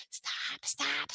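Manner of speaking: whispered, urgent retold stop; hushed scolding. Mom to scribbling child during class, retold whispered scolding. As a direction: whisper it to a child mid-lesson.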